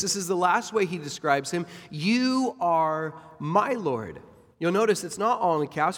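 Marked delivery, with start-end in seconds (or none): 1.9-4.2 very slow, drawn-out worshipful line; reverent slow prayer. Acted. Preacher voicing David's prayer to God, slow and reverent. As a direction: let each word rest like a whispered confession.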